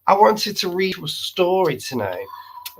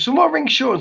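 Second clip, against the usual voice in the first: ordinary delivery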